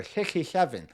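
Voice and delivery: "sixty-seven" lisped from the back of the throat; thick-tongued lisp. Retold or imitated. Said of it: "this kind of sort of lisp sort of thing".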